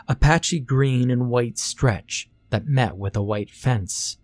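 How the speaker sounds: plain reading voice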